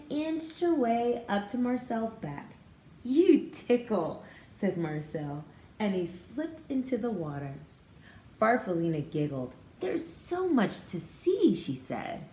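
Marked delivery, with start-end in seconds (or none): 3.0-4.2 put-on drawn-out giggly boy bird line; Marcel tickled. Marcel voiced while reading aloud. 9.8-11.5 much higher, slow wonder; amazed Farfalina. Acted. Caterpillar riding on the pond, high slow amazement.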